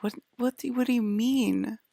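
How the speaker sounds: high stunned what do you mean; incredulous. Retold or imitated. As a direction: replay the shock of disbelief. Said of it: "I had a panic attack... I'm like".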